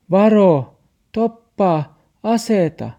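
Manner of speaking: high, loud warning yell; shout. Acted. Olli shouts a warning at Max, high and loud. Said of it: "Olli huusi".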